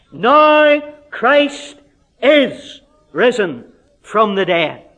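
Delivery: high, loud "now", then slow, weighty "is risen from the dead"; triumphant proclamation. Acted. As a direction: declare it as certain. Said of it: "this is his conclusion".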